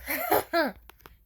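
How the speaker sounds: short high incredulous laugh; bitter laugh. Real, not acted. Reacting to the best man trying to hurt her, bitter laugh. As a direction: laugh in disbelief.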